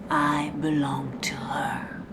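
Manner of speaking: breathy whispered vow of submission; whispered mantra. Acted. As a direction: murmur it like a secret creed. Mantra repeated softly.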